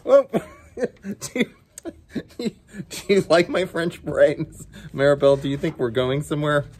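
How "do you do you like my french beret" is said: usual speaking voice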